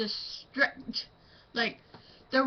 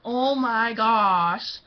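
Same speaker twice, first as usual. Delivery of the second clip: exasperated groan; 5 dB louder, much slower than usual. Acted. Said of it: "That's probably how it would act".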